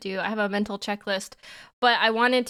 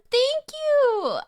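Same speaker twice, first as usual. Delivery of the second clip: excited; 4 dB louder than usual. Real, not acted. Excited thanks to chat.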